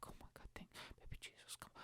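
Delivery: frantic, fast whispered prayer; barely audible panicky whisper; whispered praying. Retold or imitated. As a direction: pray under your breath, rushed and desperate. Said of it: "I'm praying to fucking God".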